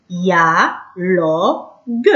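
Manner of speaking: slow, drawn-out syllables of ديالوج. Shown as a demonstration. Teacher sounding out a word for young pupils, syllable by syllable. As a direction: break ديالوج into long separate syllables. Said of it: "نقولو".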